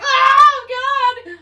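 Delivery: high, loud emotional outburst; overcome oh God. Real, not acted. Describing the hug scene, overcome and loud. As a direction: gush like it hits you right in the heart. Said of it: "I'm getting a little choked up".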